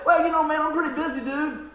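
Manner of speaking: lightly mock a lazy excuse; mock brush-off. Pastor mocking an excuse he could have given, casual brush-off.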